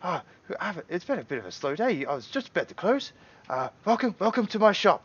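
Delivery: high, bustling welcome from a small man; halfling shopkeeper. Acted. Halfling greets the party at his door: high, flustered welcome. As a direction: fuss over the guests, squeaky and eager. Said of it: "he goes".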